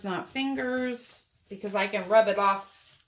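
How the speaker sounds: usual speaking voice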